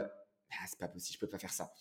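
low, very soft mutter to oneself; muttered refusal. Retold or imitated. Coach recalls rejecting the going rate, low and soft. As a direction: drop right down, thinking aloud.

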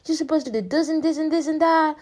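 high-pitched mock scolding, volume held back; imitated yelling. Doctor scolding a confused patient, mock yelling. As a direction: imitate a doctor's exasperated telling-off. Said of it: "she started yelling at me".

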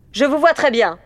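high, loud headmistress call; loud scolding from afar; shouting headmistress. A narrator's reading. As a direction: call out like a headmistress catching pupils. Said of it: "cria la directrice".

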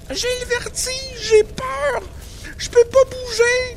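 a tiny voice frozen with fear; frightened child. Acted. Little brother paralysed by fear. (enfant apeuré, voix très aiguë et lente)

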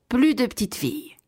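ordinary delivery

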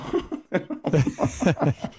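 breathless silent laugh; wheezing laugh. Real, not acted. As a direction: laugh without voice. Laughs at own joke.

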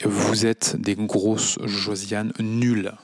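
each word slowly laid down as an insult; slow scolding. Acted. Host scolding the critics directly, slowly. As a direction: talk down to them, slowly.

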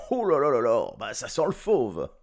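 loud, high, disgusted "oh là là"; disgusted outcry. Acted. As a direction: recoil loudly from a terrible smell.

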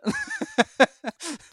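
a burst of loud, high laughter. Real, not acted. Rit fort après personne veut se mouiller. (grand éclat de rire aigu)

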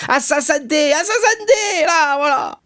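very high, loud boozy squeal; drunken toast. Acted. Drunkard empties his glass to Dantès, in a high tipsy cheer. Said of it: "dit Caderousse en vidant son verre".

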